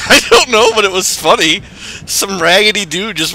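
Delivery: loud laughing talk; laughing loudly. Real, not acted. Laughing after teammate was hit.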